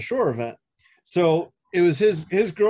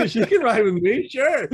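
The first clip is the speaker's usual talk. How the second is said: laughing; 7 semitones higher, 4 dB louder than usual. Retold or imitated. Eager agreement, laughing.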